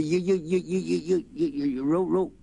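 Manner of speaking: stammering in a panic, can't get it out; boy stutters trying to warn of the fire; frantic stutter. Acted. Stuttered too bad for him.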